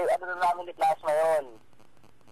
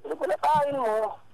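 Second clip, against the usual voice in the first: mocking echo; 9 semitones higher, much slower than usual. Real, not acted. Mocking the threat back.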